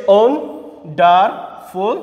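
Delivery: "Wonderful" slowly, in three separate beats; split into syllables. Shown as a demonstration. Teacher recapping the three parts, "wonderful" split syllable by syllable. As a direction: leave a pause between syllables of "wonderful". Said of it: "এখানে হচ্ছে তিনটা".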